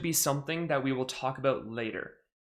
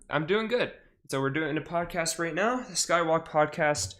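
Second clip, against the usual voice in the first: ordinary delivery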